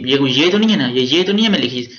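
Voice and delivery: ordinary talk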